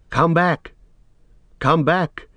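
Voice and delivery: high-pitched call from above; Sam calling. A narrator's reading. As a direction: call down to someone lost in the dark. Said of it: "he heard Sam's voice out of the blackness above".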